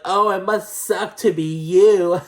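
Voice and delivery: high, loud, slow taunt breaking into a laugh; gloat, then laugh it off. Retold or imitated. Wendy laughs off Mia with a slow, high, loud taunt. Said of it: "Just laughing".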